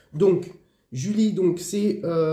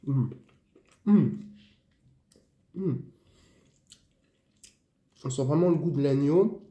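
talking between bites; chewing while talking; 3 semitones lower than usual. Real, not acted. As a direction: mouth partly full. Seen 0: Pursed, moving lips and hand at chin just after eating meat.

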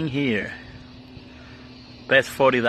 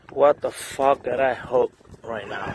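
ordinary delivery

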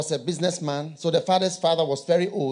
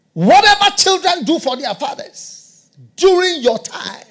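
higher, louder proclamation; preaching at full force; 12 semitones higher, 9 dB louder than usual. Real, not acted. Preacher proclaiming to congregation, loud and high. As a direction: proclaim it to the back row.

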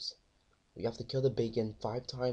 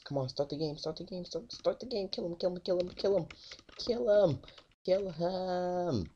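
excited; 8 semitones higher than usual. Real, not acted. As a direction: urge it on, pitched way up. Hyped chanting at game start.

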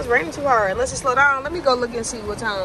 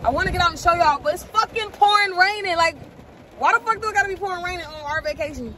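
high-pitched, exasperated complaint; fed-up venting about the weather; irritated; 8 semitones higher than usual. Real, not acted. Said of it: "I'm irritated".

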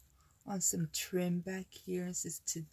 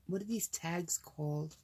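ordinary talk, nothing marked

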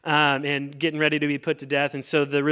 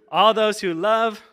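higher, louder, leading the crowd on; rousing cue; 6 semitones higher, 5 dB louder than usual. Shown as a demonstration. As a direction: lift it loud and high, inviting a response. Re-cues an unresponsive congregation.

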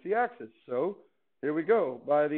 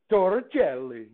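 normal talking voice

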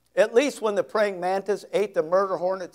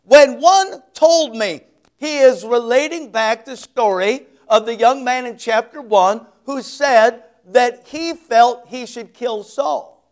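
normal talking voice

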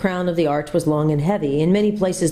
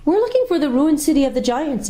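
put-on young girl's voice; 10 semitones higher than usual. A narrator's reading. Jill answers the lady.

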